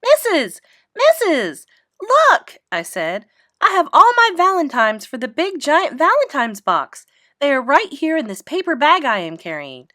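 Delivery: high, loud, bursting with excitement; very thrilled. A narrator's reading. Junie B rushing in to show Missus, thrilled and loud. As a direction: call out to the teacher, can't wait. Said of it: "very thrilled".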